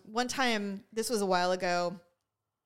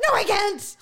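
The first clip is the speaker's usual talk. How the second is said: high, strained voice on the edge of crying; a half-laughing refusal to cry; mock holding back tears; 10 semitones higher, 7 dB louder, slower than usual. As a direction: choke it back, forcing the words out. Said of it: "I'm like".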